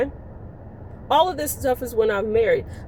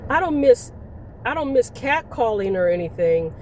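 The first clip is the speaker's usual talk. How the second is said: usual speaking voice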